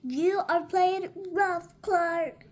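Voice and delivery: put-on kids crying out. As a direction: protest loudly to a rough playmate. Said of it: "cried the other kids".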